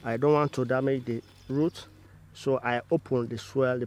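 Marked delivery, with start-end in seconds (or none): none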